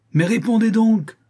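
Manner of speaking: high, loud demand for an answer; exasperated cry. A narrator's reading. Countess demands answer from silent niece, exasperated cry. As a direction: burst out at the end of your patience. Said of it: "s'écria la comtesse exaspérée".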